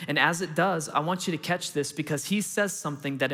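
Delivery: ordinary talk, nothing marked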